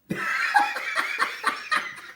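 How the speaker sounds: high burst of laughter. Real, not acted. Laughing at his own joke.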